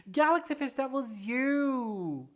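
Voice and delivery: high, slowed, stretched-out words; excited realization. Real, not acted. Streamer telling the viewer it was them, thrilled. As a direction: savor the surprise.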